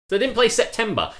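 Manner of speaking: high, loud disbelief; outraged. Real, not acted. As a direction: sound indignant at the song choice. Outraged about the disco playlist.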